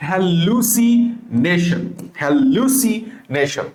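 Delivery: stretched out syllable by syllable; slow spelling drill. Shown as a demonstration. Teacher spelling a word slowly for students. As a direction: draw each syllable out for students.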